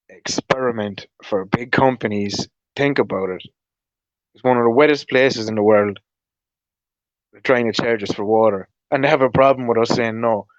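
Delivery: usual speaking voice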